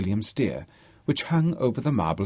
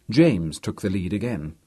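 plain reading voice

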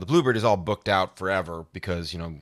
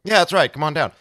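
eager welcome; 7 semitones higher, 8 dB louder than usual. Retold or imitated. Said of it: "And I say".

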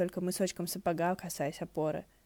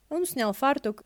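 ordinary delivery

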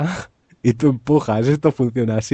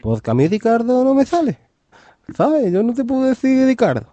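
imitating Huelva man; 14 semitones higher than usual.

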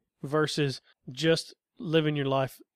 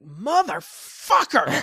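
slow, drawn-out shout of the curse; mock shout; 6 semitones higher, 4 dB louder, much slower than usual. Father imagines his son yelling a curse from his room. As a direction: yell it from a far room. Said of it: "I'll hear from his room".

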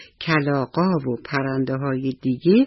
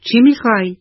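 a sharp, louder, higher demand; angry; 6 semitones higher, 6 dB louder than usual. A narrator's reading. Scarecrow angrily confronting the pigeon on his arm, loud. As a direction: snap at the bird to back off. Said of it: "مترسک با عصبانیت گفت".

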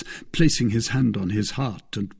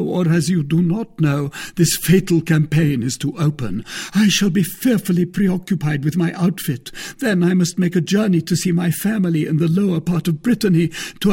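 higher, louder declamatory pleading; Porthos, earnest suitor; 8 semitones higher, 5 dB louder than usual. Acted. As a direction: make a grand heartfelt case. Porthos pleads his case.

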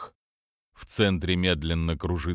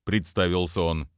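plain reading voice

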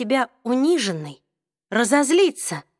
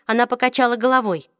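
ordinary talk, nothing marked

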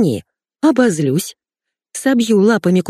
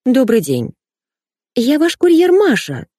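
put-on high, squeaky girlish voice; 5 semitones higher, slower than usual. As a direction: squeak like a silly young girl. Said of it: "запищала я".

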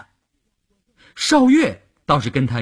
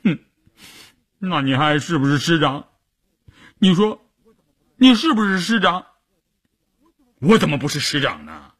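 sobbing; 6 semitones higher than usual. Acted. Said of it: "一边哭一边抽打着说".

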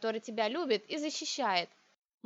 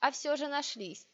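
ordinary delivery

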